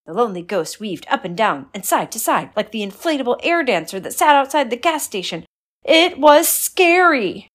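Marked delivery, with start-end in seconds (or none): 5.8-7.5 high loud slow scary line; spooky emphasis. A narrator's reading. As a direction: draw it out to spook. Narrator dramatizes fright.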